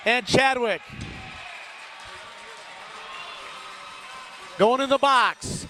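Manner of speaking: brisk live game call; radio hockey announcer calling the puck; hockey play-by-play. Acted. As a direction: call the action as it happens.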